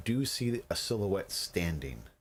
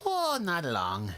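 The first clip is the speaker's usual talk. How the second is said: high, slow goblin voice; lightly voiced high goblin; goblin prisoner; 9 semitones higher, slower than usual. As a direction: sing it out slyly as a goblin.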